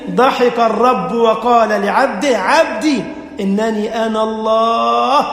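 slow, loud, weighty delivery; preacher proclaiming the words of God; solemn preaching. Acted. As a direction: let each word land slowly.

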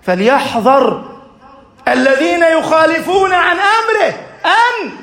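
an impassioned sermon climax; fiery preaching. Acted. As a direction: drive the lesson home at full force. Preaching at full force.

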